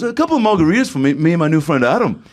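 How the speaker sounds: high, quick put-on ordering voice; high-pitched musician impersonation. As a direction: order drinks as an imitated star. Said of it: "he goes, he goes".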